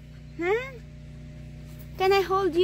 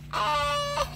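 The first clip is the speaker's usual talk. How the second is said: high squeal into a laugh; real nervous yelp; startled squeal; 9 semitones higher, 7 dB quieter than usual. Real, not acted. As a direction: flinch as the pony eats. Pony nips at the food.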